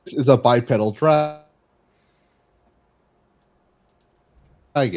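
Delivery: choppy lagging audio; words cutting out on a bad connection; buffering stutter. Real, not acted. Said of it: "You cut out for a second".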